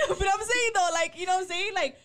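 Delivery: talking through laughter; words breaking with laughter; laughing. Real, not acted.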